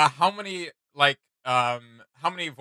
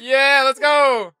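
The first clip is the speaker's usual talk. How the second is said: slow, much higher, louder cheer of triumph; excited shout; 11 semitones higher, 10 dB louder, much slower than usual. Real, not acted. Cheering to co-host after catching the line, excited shout. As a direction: yell it out, stretched and thrilled.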